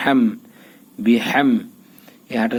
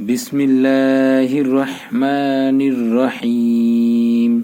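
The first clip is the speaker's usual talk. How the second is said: slow melodic chant with long held vowels; Quran recitation; much slower than usual. Acted. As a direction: recite the surah slowly in tajweed style. Recites the whole surah.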